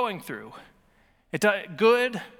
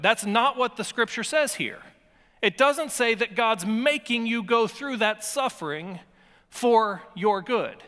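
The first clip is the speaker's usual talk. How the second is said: ordinary delivery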